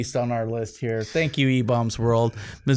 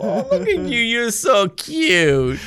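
very high, slow doting grandma coo; pinch-the-cheeks grandma voice; 10 semitones higher, 3 dB louder, slower than usual. Retold or imitated. Co-host coos at mugshot like a cheek-pinching grandma, very high and slow. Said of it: "grandma style".

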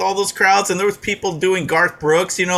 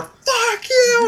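high-pitched mob yell; imitated angry crowd shout. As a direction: shout it like thousands of hecklers. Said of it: "just yelling at her".

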